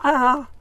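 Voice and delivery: sharp loud "ah"; pained yelp from heat. Real, not acted. Burning mouth.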